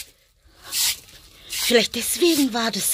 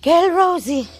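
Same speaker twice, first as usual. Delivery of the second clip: higher coaxing voice to the rabbit; pet talk; 7 semitones higher, 5 dB louder, slower than usual. Real, not acted. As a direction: coo it at Rosie. Spricht zum Hasen. (hohe Kosestimme zum Hasen)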